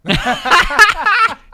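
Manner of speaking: a loud, high burst of laughter; big high laugh. Real, not acted. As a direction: explode into laughter. Laughs at winning.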